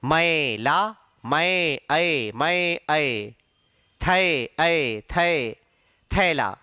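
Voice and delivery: teacher breaking मैला and थैला down to open ऐ; modern pronunciation. Shown as a demonstration. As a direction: isolate open "ae" in मै and थै, low and separate. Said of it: "आजकल हम इसको मई, थई नहीं बोलते".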